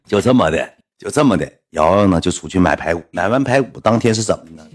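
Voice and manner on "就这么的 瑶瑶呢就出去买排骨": ordinary delivery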